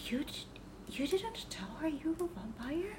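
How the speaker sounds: soft, hushed question behind her back; hushed whisper. Acted. Player whispers to Shion about Yuki, hushed. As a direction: lean in and whisper it so she can't hear. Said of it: "I whisper to him".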